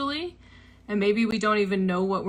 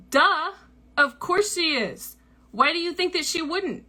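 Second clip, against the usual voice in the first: blunt friend; 7 semitones higher than usual. Retold or imitated. Friend bluntly telling the story's teller the obvious, mock knowing. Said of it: "super direct".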